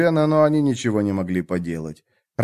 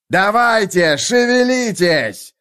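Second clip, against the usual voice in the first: high stretched shout down the hill; 9 semitones higher, 4 dB louder, slower than usual. Acted. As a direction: yell it slowly uphill. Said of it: "кричал Хакон".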